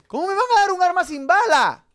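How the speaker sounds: high, loud outraged disbelief; exasperated complaint. Real, not acted. Streamer ranting to chat about a gun with no bullets, exasperated. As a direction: rant at the unfair game.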